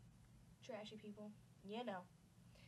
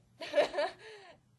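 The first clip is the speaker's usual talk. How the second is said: loud, high burst of laughter; cracking up hard; 9 semitones higher, 16 dB louder than usual. Real, not acted. Narrator breaks into a loud high laugh at her own joke.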